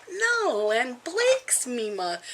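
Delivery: high, slow sing-song protest; playful insistence. Retold or imitated. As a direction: tease the toddler, drawn out. Grandma playfully insists back to grandson.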